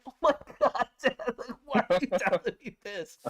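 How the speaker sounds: high, loud, laughing disbelief; mock-horrified plea through laughter; appalled protest. Son hearing too much from his dad, appalled.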